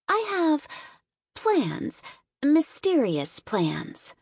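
high, secretive princess voice, slowing down; cartoon princess. A narrator's reading. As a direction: tease the mysterious plans, drawing them out slowly. Princess speaks mysteriously.

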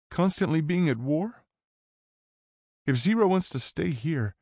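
put-on high, pleading teen girl; young woman voice. A narrator's reading.

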